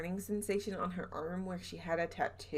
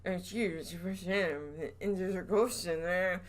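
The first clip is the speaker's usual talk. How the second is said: southern accent imitation. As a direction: mimic the caretaker's thick Southern accent, teeth nearly shut. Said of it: "his southern accent was".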